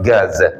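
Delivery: "Gaza" with a plain hard g; dialect pronunciation. Shown as a demonstration. Teacher repeating the dialect name, hard g. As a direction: say "Gaza" with g as in go, no throat friction. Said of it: "Tadi baca ga".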